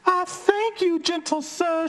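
high squeaky fly voice; tiny high-pitched polite voice. Acted. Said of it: "she said".

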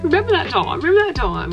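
mock silly voice. Mocking own past video.

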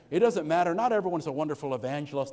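ordinary delivery

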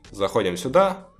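usual speaking voice

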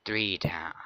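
creepy whispered tally; gloating whisper. Acted. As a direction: whisper it like a satisfied killer. Killer counting victims.

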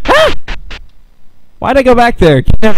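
very high, loud scream of fright; real fright, screamed high and loud; frightened shriek. Real, not acted. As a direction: shriek and flee. Scared by enemy.

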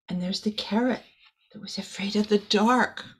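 spooky hushed whisper for the scared carrot; whispering about a carrot afraid of the dark. Acted. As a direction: breathe it like a secret in the dark.

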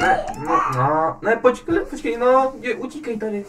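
high, loud panicked urging; frantic shouting. Real, not acted. Player shouts high and loud as the round goes wrong. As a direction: yell at the character to hurry.

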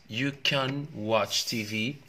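very slow, stretched reading; slow classroom model; slow modelling. Shown as a demonstration. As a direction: spell out each word slowly. Teacher modelling sentence slowly.

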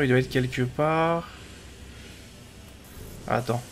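usual speaking voice

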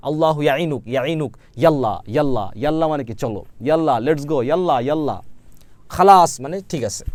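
ordinary talk, nothing marked

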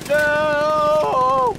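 high, loud panicked no; panicked shout. Real, not acted. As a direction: yell no as the ambush hits. Ambush goes wrong, cries out.

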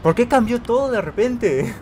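high, drawn-out protest of surprise; baffled exclamation. Real, not acted. Player asking why everything changed, astonished. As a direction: wail it slowly, high with disbelief.

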